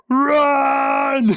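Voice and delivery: high, loud yelp of panic; panicked shout. Retold or imitated. Orcs fleeing a Bloodthirster, loud panicked yell. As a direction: scream it as you flee. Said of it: "go past and like".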